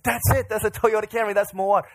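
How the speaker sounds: high, urgent imitation of an excited friend; excited spotting. Friend spotting the ride coming back around, excited cry. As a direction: cry it out as if pointing at the car. Joyce excitedly spots the car again.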